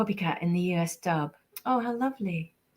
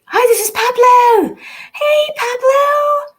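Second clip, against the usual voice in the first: very high, loud drawn-out hello; excited greeting; 10 dB louder, slower than usual. Acted. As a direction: call out a big high-pitched greeting. Greets a fan called Pablo.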